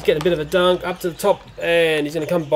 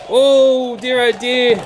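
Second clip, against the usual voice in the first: mock dismay; 5 semitones higher, 3 dB louder, much slower than usual. Dinosaur smashes the playset.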